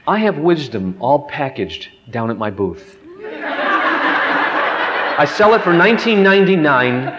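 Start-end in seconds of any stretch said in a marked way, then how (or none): 3.2-7.1 slow, higher hammed-up huckster line through a laugh; mock salesman pitch. Preacher joking to the congregation about a booth, slow mock sales pitch.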